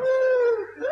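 short high amused laugh. Acted. Eder laughing at the kobold in the snow, high amused laugh. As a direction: burst out laughing.

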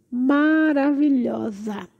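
slow, swooning praise; drawn-out admiration. Real, not acted. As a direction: stretch it out in wonder.

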